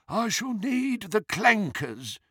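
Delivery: high, slow goblin voice; old goblin. A narrator's reading. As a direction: give the order slowly in a high goblin voice. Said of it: "he told the goblin".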